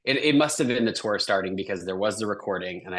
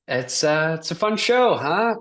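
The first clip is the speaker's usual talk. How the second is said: awkward chit-chat; 7 semitones higher, 5 dB louder than usual. Retold or imitated. Awkward chat with audition team.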